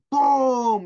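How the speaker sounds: booming thunder. A narrator's reading. Dramatic thunder in story.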